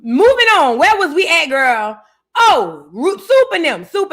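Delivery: high and loud, forcing back on track; loud restart. Real, not acted. Snapping back to the topic for the listeners, loud and high. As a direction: bellow it to change the subject.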